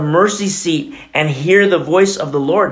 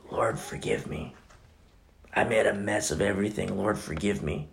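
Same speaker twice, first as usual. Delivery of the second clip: whispered, much softer plea for forgiveness; hushed prayer; 5 semitones lower, 10 dB quieter than usual. Acted. Preacher acting out a repentant prayer, whispered and soft. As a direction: pray it low and contrite, almost under the breath.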